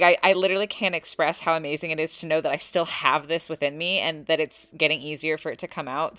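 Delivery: usual speaking voice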